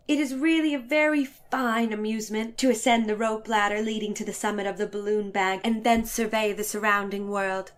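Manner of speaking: plain reading voice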